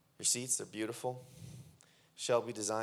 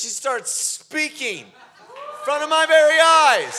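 elated; 8 semitones higher, 10 dB louder, slower than usual. Real, not acted. Joyful recounting of the healing.

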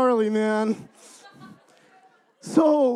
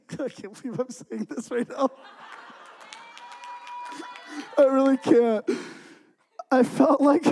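high, laughing disbelief at oneself; embarrassed laughter; 4 semitones higher than usual. Real, not acted. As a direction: laugh helplessly through the confession. Embarrassed laughing before odd confession.

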